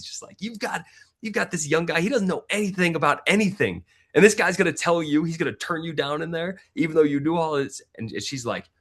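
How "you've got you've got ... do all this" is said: high-pitched imitation of an indignant wife; retold outrage, pitched well up; pissed-off wife. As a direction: rant in the voice of a fiery, assertive wife. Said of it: "and she's pissed".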